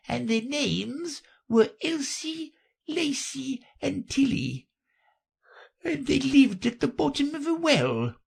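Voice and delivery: very high, halting creature voice; drowsy, feeble little storyteller; sleepy Dormouse storytelling. Acted. The Dormouse tells Alice of three sisters, drowsy and high.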